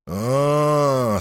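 long, drawn-out voluptuous "oh"; lustful sigh. A narrator's reading. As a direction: sigh it with relish. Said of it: "сладострастно вздохнул он". (сладострастный вздох)

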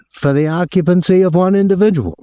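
high-pitched, aggrieved hotel official; aggrieved man. A narrator's reading. Hotel man phoning a guest about his visitor, aggrieved. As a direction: put on a high, officious voice of complaint.

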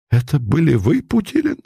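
can't believe it is him; stunned disbelief. Acted. Savin realising the priest was Putilin, stunned.

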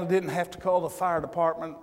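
ordinary talk

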